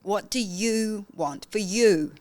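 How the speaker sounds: slow, drawn-out emphasis on both "you"s; stress on YOU. Shown as a demonstration. Coach asking what the client wants, stressing "you". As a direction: stretch and press each "you". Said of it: "just change an inflection".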